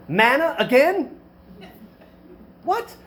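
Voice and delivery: high, slow disbelieving gripe; mock complaining. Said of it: "they're complaining about the food".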